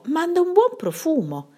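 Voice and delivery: butter up the witch; coaxing flattery to soothe her; flattering. A narrator's reading. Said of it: "per rabbonirla".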